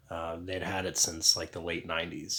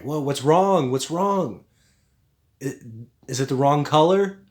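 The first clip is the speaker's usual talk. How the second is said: higher and louder, startled and concerned; alarmed aunt; 6 semitones higher, 10 dB louder than usual. Retold or imitated. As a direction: burst out worried, what's wrong. Aunt alarmed by sudden crying.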